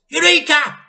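high, loud joyful cry; triumphant cry. A narrator's reading. Mr Cranky discovering the answer, triumphant cry. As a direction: shout the discovery. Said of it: "cried Mister Cranky".

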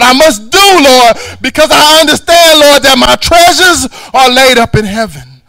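loud, rising preacher's rebuke; preaching at full force. Acted. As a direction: hammer it home from the pulpit. Climactic prayer, shouted.

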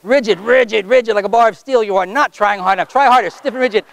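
rhythmic, insistent repeated commands; stage hypnotist pressing the arm rigid; hypnotic suggestion. Acted. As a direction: chant the suggestion to lock the arm.

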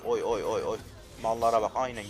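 ordinary delivery

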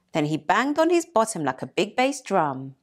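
usual speaking voice

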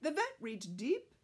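usual speaking voice

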